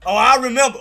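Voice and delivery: high, loud playful accusation; hammed-up vengeful remembering; mock grudge. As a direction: call out the tease at full volume. Playful grudge over earlier teasing.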